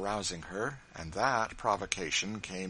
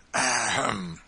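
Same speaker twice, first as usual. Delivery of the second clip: throat clearing; 8 dB louder than usual. A narrator's reading. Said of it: "usual means of gaining time".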